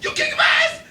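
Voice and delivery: very high, loud mock screaming rage; Mister Watts. Retelling his furious answer to the threat, losing it. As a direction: imitate a man losing it, yelling through laughter. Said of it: "Mister Watts is losing it".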